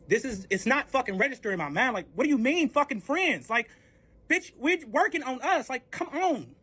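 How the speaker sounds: high, drawn-out indignant protest; worked-up pleading outrage; exasperated disbelief. Retold or imitated. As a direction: stretch the words out in incredulous protest. Acted disbelief at breakup.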